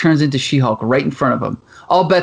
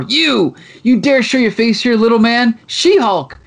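mock angry She-Hulk; 6 semitones higher than usual. She-Hulk confronts kidnapper, pissed off.

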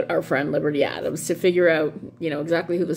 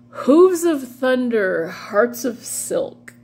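mock-dramatic title; 6 semitones higher, 4 dB louder, slower than usual. Real, not acted. Savouring a trashy book title.

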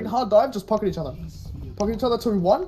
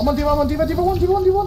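urgent callout shout; 11 semitones higher, 7 dB louder, faster than usual. Real, not acted. Frantic callouts in team fight.

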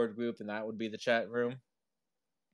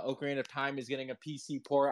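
ordinary talk

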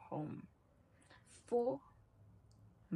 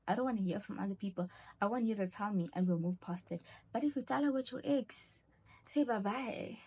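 ordinary delivery

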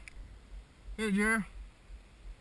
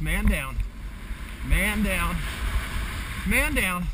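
calling out loudly up the slope; loud alarmed call, repeated; man down shout; 3 dB louder, much slower than usual. Real, not acted. Calling out a fallen skier.

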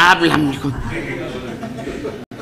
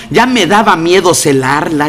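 ordinary talk

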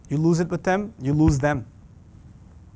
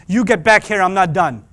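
louder mock parent yell; 7 dB louder than usual. Parent yelling at a leaving child, louder. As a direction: call the kid back angrily. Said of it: "you can't keep saying".

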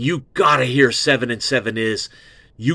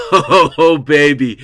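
excited gush; 4 semitones higher, 8 dB louder, much slower than usual. Real, not acted. Host gushing over favorite song.

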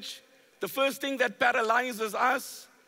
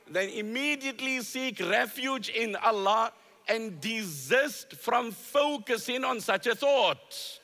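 normal talking voice

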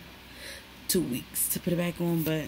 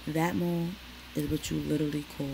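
normal talking voice

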